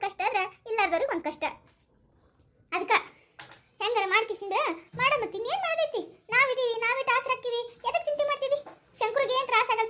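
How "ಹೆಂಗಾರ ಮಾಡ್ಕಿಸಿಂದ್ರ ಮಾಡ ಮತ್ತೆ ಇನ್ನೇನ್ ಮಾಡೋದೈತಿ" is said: usual speaking voice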